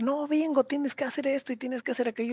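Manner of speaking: put-on a child ordering her sister about; imitating a bossy little girl yelling; mock yell. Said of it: "le empieza a gritar".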